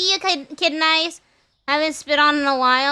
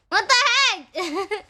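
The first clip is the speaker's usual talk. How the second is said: usual speaking voice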